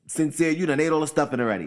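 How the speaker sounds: a relative teases him for eating the stuffing; mock scolding relative. Family scolding him over stuffing.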